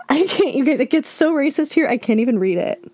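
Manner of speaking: can't believe what she is reading; shocked disbelief. Real, not acted. Reacting to racist messages.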